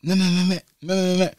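high mock panicked nah, nah; imitating panicked driver. Mocking the panicking driver in the van, high. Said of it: "He's just like".

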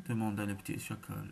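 plain reading voice

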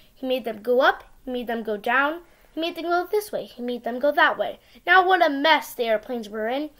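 usual speaking voice

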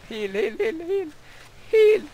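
rising high frantic repeats; frantic pleading. Real, not acted. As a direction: mash the heal in a panic. Frantically healing while attacked.

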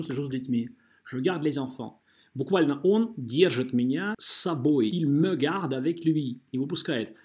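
normal talking voice

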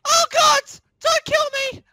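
loud, frantic screams for mercy; panicked screaming. Real, not acted. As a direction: scream in terror. Being attacked in game.

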